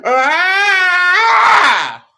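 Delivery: long, high, loud shriek; hammed-up shriek of panic, held long; panicked scream. Kid panicking as the pitch comes, panicked scream. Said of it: "I did this".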